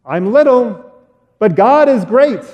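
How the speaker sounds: lightly voiced brave boy; brave little boy. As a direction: pipe up bravely like a small boy. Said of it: "David looks up Goliath".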